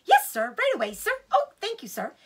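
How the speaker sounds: eager small badger. Small badger thanks Mister Fox, high and eager. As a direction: bubble over with gratitude.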